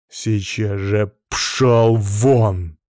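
slow, drawn-out angry order; commanding, heavily stretched angry dismissal. A narrator's reading. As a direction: throw him out, stretching each word. Angrily orders his brother out.

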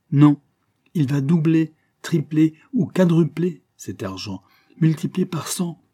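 a gambler's fevered logic, driven upward; energetic. A narrator's reading. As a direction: press the numbers with growing heat. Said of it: "Il avait parlé avec une énergie".

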